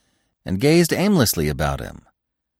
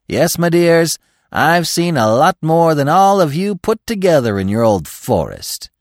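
higher, louder bragging to his dears; boastful; 7 semitones higher, 6 dB louder than usual. A narrator's reading. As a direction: puff up and show off. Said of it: "He sounded somewhat boastful".

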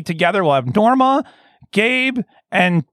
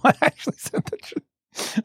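laughing; 7 semitones higher, 7 dB quieter, slower than usual. Real, not acted. Laughing while insisting it's real.

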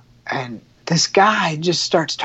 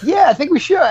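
laughing yes; 10 semitones higher, 4 dB louder than usual. Retold or imitated. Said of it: "I was like".

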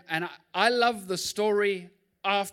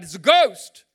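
a sudden loud frightened cry; terrified cry; 14 dB louder, slower than usual. A narrator's reading. Pastor voicing disciples, loud frightened cry. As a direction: disciples yelling in panic at a figure on the water. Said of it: "In fear, they cried out".

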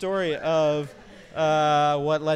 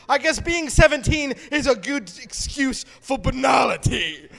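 higher, loud shouting British chef rant; Gordon Ramsay impression; 8 semitones higher, 9 dB louder than usual. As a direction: bark it like an angry TV chef. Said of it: "This is totally Gordon Ramsay".

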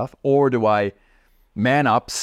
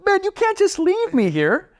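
much higher, hammed-up plea; pleading. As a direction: beg him not to leave, voice way up. Begging guide not to leave.

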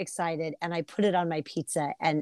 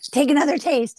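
high, louder eager push to taste again; eager urging; 9 semitones higher, 6 dB louder than usual. Retold or imitated. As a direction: urge her to try once more, excitedly. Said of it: "And I'm like".

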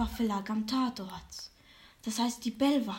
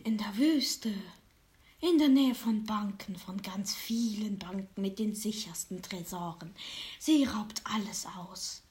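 fortune teller. Acted. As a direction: whisper it like a secret. Fortune teller whispers throughout.

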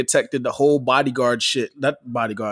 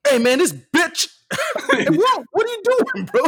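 mock Gus outburst; 14 semitones higher than usual. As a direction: ham up your buddy blurting rude things on a train. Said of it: "he'd be like".

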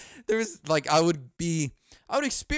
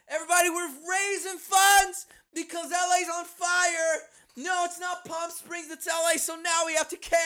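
high, hammed-up telethon host voice; shouted mock fundraiser cheer; mock celebrity hype; 10 semitones higher than usual. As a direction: whoop it up like a fake charity event host.

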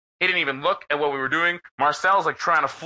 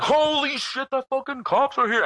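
much higher, alarmed cry as the cops show up; mock panic; 11 semitones higher than usual. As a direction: yelp like everyone just got caught. Partygoers panic at cops' arrival.

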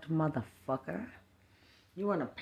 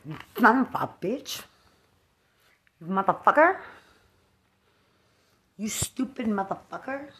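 loud, higher cursing bursts; angry cursing; 5 semitones higher, 5 dB louder than usual. Real, not acted. Cursing the absent woman in a loud angry rant. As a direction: spit each curse out in fury. Ranting alone, cursing loudly.

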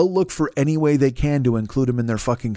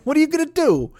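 mocking yell; 10 semitones higher than usual. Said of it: "it's like".

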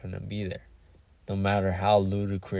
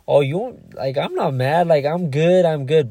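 higher, louder breezy "I'm good" brush-off; fake nonchalant; 7 semitones higher, 10 dB louder than usual. To a friend, insisting he isn't mad: higher, louder mock nonchalance. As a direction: wave it off like nothing's wrong, too brightly. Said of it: "somebody who wants to be nonchalant".

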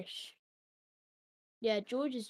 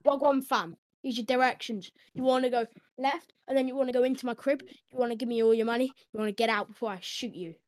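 fast, louder street-slang Siri; roadman Siri; 6 dB louder, faster than usual. Pretend. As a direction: rattle off directions like a roadman Siri. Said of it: "he'd be like".

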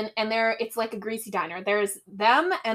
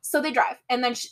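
ordinary talk

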